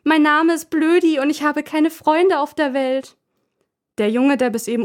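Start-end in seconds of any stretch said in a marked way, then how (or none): 0.0-3.2 high, loud taunting yell; boy yelling a mocking line for the doll; mocking shout. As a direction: bellow the taunt for laughs. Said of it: "Er schreit".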